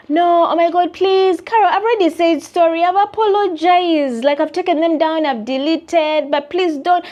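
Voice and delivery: high, loud whiny pleading; begging client. Retold or imitated. Imitating the client begging her not to count deliverables, whiny. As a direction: whine and beg in a put-on voice.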